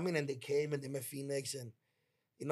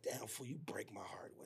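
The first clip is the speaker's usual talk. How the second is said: slow, whispered and sorrowful; heartbroken whisper; 5 semitones lower, 12 dB quieter than usual. Retold or imitated. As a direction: let it fall out softly, heartbroken. Heartbroken words to friend.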